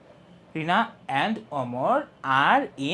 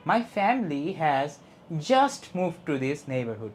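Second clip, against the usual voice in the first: slow reading, pause before a stressed just; 2 semitones lower, much slower than usual. Shown as a demonstration. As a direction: set just apart and lean on it. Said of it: "এইটা হলো stressed word".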